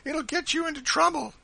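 high, alarmed woman's warning; worried, high-pitched caution; startled warning. A narrator's reading. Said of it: "looking surprised".

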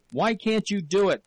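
loud, high angry shout. A narrator's reading. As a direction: yell the question. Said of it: "he shouted".

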